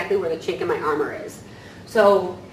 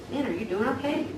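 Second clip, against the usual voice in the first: softer, higher caring question; gentle concern; 4 semitones higher, 5 dB quieter than usual. Retold or imitated. Choosing to check on an upset coworker, soft caring voice. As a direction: reach out kindly instead of snapping. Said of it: "override that and say".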